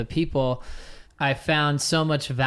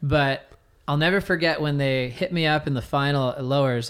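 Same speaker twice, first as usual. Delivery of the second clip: ordinary talk, nothing marked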